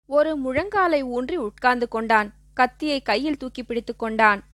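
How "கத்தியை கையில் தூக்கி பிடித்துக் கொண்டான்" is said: plain reading voice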